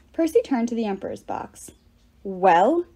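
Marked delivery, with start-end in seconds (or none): 2.2-2.9 challenging yell. A narrator's reading. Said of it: "he yelled at Bacchus".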